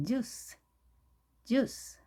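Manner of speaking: "주스" as English juice, short and tense; one fast syllable "주스" with a tight j; quick English pronunciation. Shown as a demonstration. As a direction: model "주스" briskly for learners. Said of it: "이 발음을 빠르게".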